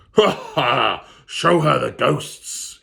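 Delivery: loud, slow laughing demon; laughing command. Acted. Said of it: "he cried".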